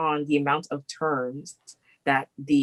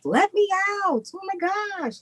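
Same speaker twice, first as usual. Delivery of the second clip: desperate plea. Retold or imitated. Mimicking people stuck at home.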